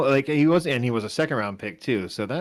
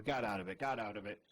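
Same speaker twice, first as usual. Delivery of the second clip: ordinary talk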